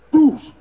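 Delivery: a high shouted doosh; crash sound effect. Retold or imitated. Speaker acting the door smash, loud high doosh. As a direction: smash the word out like a door giving.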